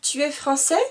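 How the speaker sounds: Tu es français? rising on français; rising question. Shown as a demonstration. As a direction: push the pitch up on the last word français. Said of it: "l'intonation monte".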